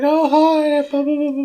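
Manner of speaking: chirpy put-on woman's voice; imitating Jackie. Retelling Jackie greeting Ordell, high mock-sweet. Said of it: "And she's like".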